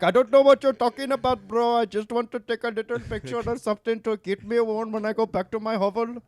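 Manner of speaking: mocking character voice. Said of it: "And he's like".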